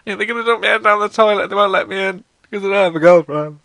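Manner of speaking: high, loud, whining fear; scared kid. Retold or imitated. A boy tells his mum, high and scared. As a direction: plead like a frightened child. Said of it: "you tell your mum 'cause you're scared".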